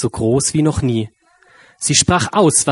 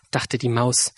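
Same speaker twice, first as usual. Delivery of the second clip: usual speaking voice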